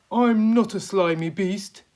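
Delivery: a worm defending his honour; indignant Earthworm. Acted. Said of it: "the Earthworm said".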